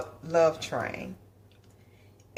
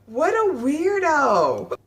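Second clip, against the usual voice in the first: self-mocking; 9 semitones higher, 3 dB louder, slower than usual. Real, not acted. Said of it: "calls herself weirdo".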